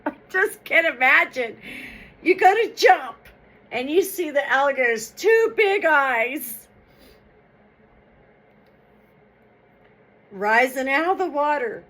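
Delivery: leaping into the deer's fright with a rising voice; excited aside. Real, not acted. Reader steps out of the tale to picture the jump, excited. Reader imagines the scary moment.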